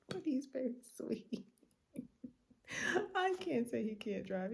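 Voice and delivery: high, giggly speech; laughing while talking. Real, not acted. Vlogger giggles about her sweet reckless driver. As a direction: talk through the giggles.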